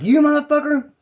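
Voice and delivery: high-pitched mock yell; retold angry outburst. Man spotting his target before the chase, mock angry. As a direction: imitate someone spotting an enemy.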